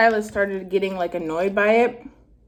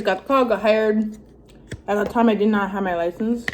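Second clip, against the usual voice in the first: ordinary talk, nothing marked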